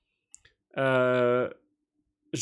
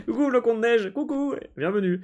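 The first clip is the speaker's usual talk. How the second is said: cheery greeting; 10 semitones higher than usual. Real, not acted. Greeting a newcomer.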